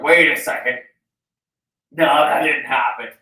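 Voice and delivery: loud startled outburst; shouting. Retold or imitated. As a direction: blurt it out loud, alarmed. Waking from dream, shouting.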